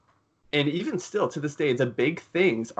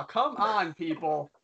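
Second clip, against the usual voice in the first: usual speaking voice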